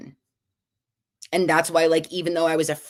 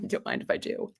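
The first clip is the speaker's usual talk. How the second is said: whispered low mock voice; 9 dB quieter than usual. Jokey reply to grape offer.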